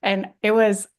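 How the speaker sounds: usual speaking voice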